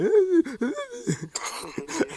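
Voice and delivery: very high, loud fake sobbing; exaggerated panicked squeal breaking into laughter; hammed-up high wail; mock crying wail. Teller imitates own panicked reaction.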